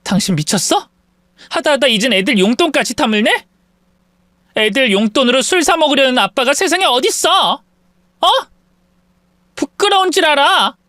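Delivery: high and rising, building into a furious yell; yelling at husband. Acted. Wife yells at drunk husband over kids' money, furious. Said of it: "남편에게 소리쳤죠".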